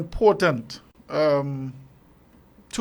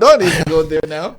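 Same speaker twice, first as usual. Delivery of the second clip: playful teasing; 3 semitones higher, 9 dB louder than usual. Real, not acted. Teasing host cuts in.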